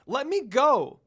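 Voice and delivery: loud, high desperate plea; little girl carried off, shouting to be let go; begging shout. Acted. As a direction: scream to be set free. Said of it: "Rin begged".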